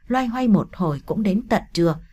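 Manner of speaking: ordinary delivery, nothing marked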